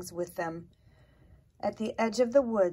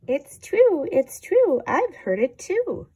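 excited squeaky mice; 8 semitones higher, 11 dB louder than usual. A narrator's reading.

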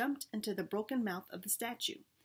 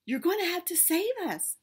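higher, louder call over the wind; 9 semitones higher, 6 dB louder than usual. A narrator's reading. Penny calling to Sherman as they fall, raised voice. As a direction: call out while falling. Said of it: "Penny called".

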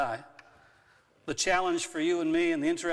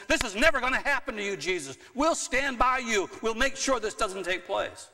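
higher and louder, emotions running high; lifted voice, emotions involved; raised emotional voice; 8 semitones higher, 5 dB louder than usual. Retold or imitated. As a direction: Peter pleading loudly to Jesus. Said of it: "his voice began to lift".

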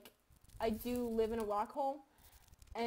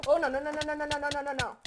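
panicked no; 8 dB louder, faster than usual. Real, not acted. Panic in game.

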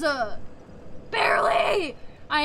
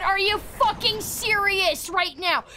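ordinary talk, nothing marked

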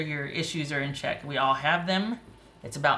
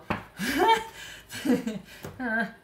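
higher, drawn-out burst of laughter; emotional laugh; 7 semitones higher than usual. Real, not acted. Reader getting all emotional with pride, emotional laugh.

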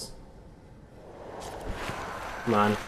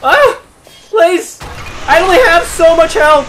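high, loud desperate plea; frantic and frightened, voice pitched way up; panicked pleading; 10 semitones higher, 5 dB louder than usual. Real, not acted. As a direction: beg for your life in a high yelp. Pleading as the boss attacks.

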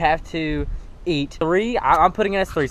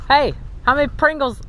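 ordinary talk

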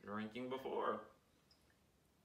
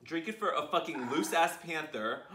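ordinary delivery

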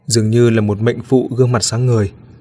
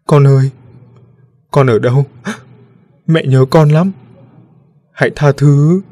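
mother's louder, higher plaintive call; acted sobbing; 3 semitones higher, 4 dB louder than usual. A narrator's reading. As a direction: call out for the lost child, pleading through acted tears. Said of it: "tiếng khóc nức nở". (tiếng mẹ khóc gọi con, diễn tả)

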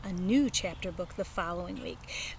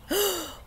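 sharp, high gasp of alarm; 11 semitones higher than usual. Acted. Girl on phone realising her friend sounds sick, a high gasp. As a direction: gasp as if struck by a scary thought. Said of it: "All of a sudden I did a gasp".

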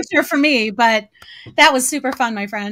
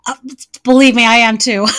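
usual speaking voice

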